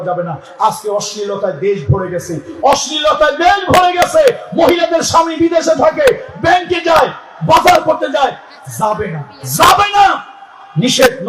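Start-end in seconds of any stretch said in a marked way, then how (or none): 2.6-4.4 অশ্লীলতায় দেশ ভরে গেছে shouted high, দেশ hit hardest; shouted repeat. Shown as a demonstration. Preacher repeating it to the crowd as a shout. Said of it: "repeated louder". 4.5-11.3 high, loud shouted sermon; fiery preaching. Acted. Preacher warning the congregation in a high shout.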